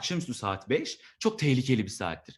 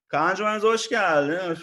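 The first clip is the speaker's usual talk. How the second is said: syrupy manager; 4 semitones higher, 5 dB louder than usual. Retold or imitated. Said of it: "garip bir, daha duymadığım bir zamirle".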